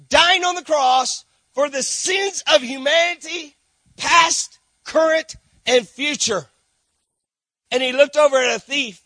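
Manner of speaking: impassioned preaching. Acted. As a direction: lift the room toward the cross. Preaching crescendo about the cross.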